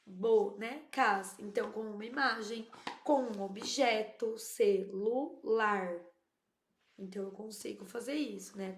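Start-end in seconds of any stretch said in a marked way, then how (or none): 0.1-1.4 "bonecas" very slowly, bo-ne-cas; drawn-out count of bo... ne... cas; syllable by syllable. Shown as a demonstration. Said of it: "pra que ela segmente palavras em sílabas". 4.4-6.0 "celular" very slowly, ce-lu-lar; drawn-out three-beat ce... lu... lar; syllable by syllable. Shown as a demonstration. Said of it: "pra que ela segmente palavras em sílabas".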